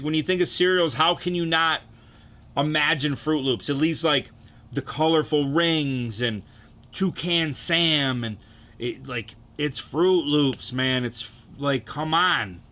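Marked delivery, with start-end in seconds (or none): none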